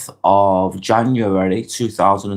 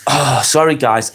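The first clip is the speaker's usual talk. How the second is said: usual speaking voice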